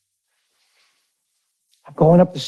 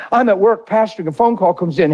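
normal talking voice

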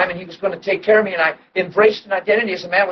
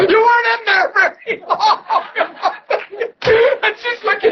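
laughing. Retold or imitated. Wife's reply, told laughing.

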